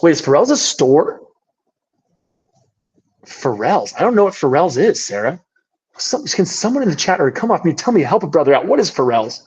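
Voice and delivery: ordinary talk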